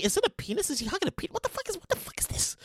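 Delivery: soft, hushed incredulous question; whispered disbelief. Real, not acted. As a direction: drop to a stunned whisper.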